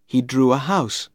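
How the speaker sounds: ordinary delivery, nothing marked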